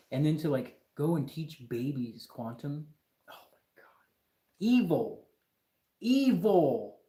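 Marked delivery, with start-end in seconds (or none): 3.3-3.9 aghast, voiceless; shocked whisper. Real, not acted. Aghast at teaching babies quantum. 4.6-7.0 loud, higher "Evil" with a hammy fall; mock outrage. Real, not acted. Outraged at modern science.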